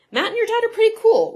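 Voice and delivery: high, loud, quick retort; imitating an eager kid; enthusiastic defence. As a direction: pipe up brightly in their defence. Gordo defends afternoon, retold.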